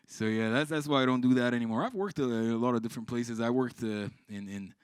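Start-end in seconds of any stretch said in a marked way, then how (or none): none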